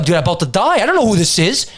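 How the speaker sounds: ordinary talk, nothing marked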